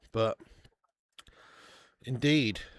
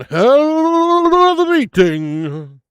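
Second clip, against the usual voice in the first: long, drawn-out stadium call, much louder; commentator bellow; 13 semitones higher, 15 dB louder, much slower than usual. Acted. Streamer bellowing the half-time scoreline to viewers like an announcer. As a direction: belt it like a match announcer.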